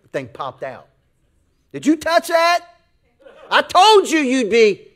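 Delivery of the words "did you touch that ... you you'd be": high, loud, hammed-up scolding; scolding dad. Dad catching the boy who touched the lighter, comic scolding. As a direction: holler it, stretching out the I-told-you-so.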